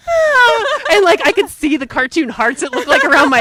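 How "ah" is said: high, loud delighted squeal; fangirl squeal. Retold or imitated. Hearing Crosby introduce himself, high squeal. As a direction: squeal like a starstruck fan. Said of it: "I'm like".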